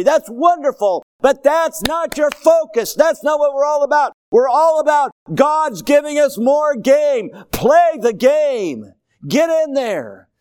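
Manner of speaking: loud, high, impassioned pulpit shout; full-force preaching. Real, not acted. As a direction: drive each phrase home at the top of your voice.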